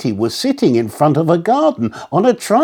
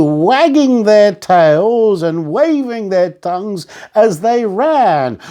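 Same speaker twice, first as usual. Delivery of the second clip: usual speaking voice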